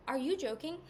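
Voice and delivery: loud, incredulous Are you joking?; kinda loud. Retold or imitated. Friend reacting to crying at the vet, loud disbelief. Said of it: "Like, kinda loudly".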